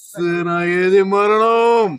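slow, loud heroic war cry; menacing movie punchline. Acted. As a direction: declaim it like a warrior king. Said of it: "different సినిమాలు చెప్పు".

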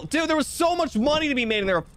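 high excited outburst. Real, not acted. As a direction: gush about the loot. Excited about the money.

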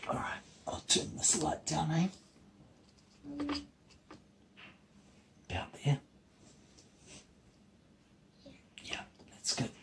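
real hushed whisper; bedtime whisper. Real, not acted. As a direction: whisper softly as the light goes down. Settling children to sleep at night.

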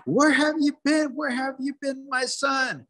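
high-pitched, wailing mother's cry. Acted. Mother to her son gone sixty days, crying out high. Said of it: "she starts to crying".

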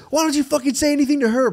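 mock angry driver. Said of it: "what he was like".

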